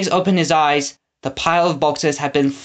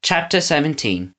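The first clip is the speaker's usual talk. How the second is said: usual speaking voice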